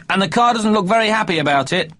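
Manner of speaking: put-on high child voice; little boy voice. A narrator's reading. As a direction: speak like an excited small boy. Child character.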